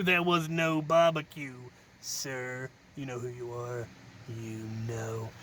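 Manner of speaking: low, slow, menacing mock threat; hammed-up villain warning, low and slow; mock ominous accusation. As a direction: lean in and accuse someone darkly.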